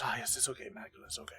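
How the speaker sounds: soft, hushed, fading to a whisper; calm the beast gently; soothing the dog. Acted. Baltazar quieting the growling Magdalene, soft and soothing.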